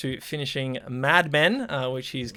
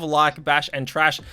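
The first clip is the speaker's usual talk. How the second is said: usual speaking voice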